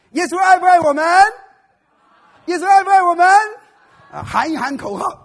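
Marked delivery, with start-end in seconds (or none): 0.1-3.3 rallying shout. Acted. Brother rallies congregation.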